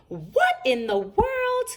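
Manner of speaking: high, slow astonished cry; astonished Mama. A narrator's reading. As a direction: gasp at the mess on the plate. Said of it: "commented Mama".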